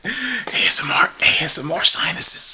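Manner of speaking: breathy joking whisper; ASMR whisper. Acted.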